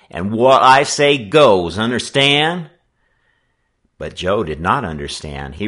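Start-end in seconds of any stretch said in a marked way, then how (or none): 0.1-2.7 loud, high, slow bossing; drawn-out, overbearing challenge; domineering. Acted. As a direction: lay down the law as gang boss. Gang leader lays down law.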